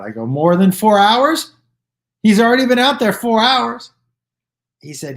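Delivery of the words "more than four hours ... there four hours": loud, high-pitched disbelief, rising at the end; incredulous protest. Retold or imitated. Retelling a protest to the bouncer about waiting, incredulous. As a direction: protest in raised, appalled disbelief.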